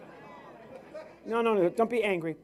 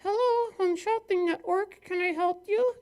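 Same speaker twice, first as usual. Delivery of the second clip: very high put-on receptionist voice; shopping channel operator; 13 semitones higher than usual. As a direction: answer the phone in a high singsong mock voice. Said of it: "Call that number and do this".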